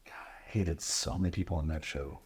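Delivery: whispered confession; unvoiced, soft aside. Real, not acted. Confessing dislike of castmates.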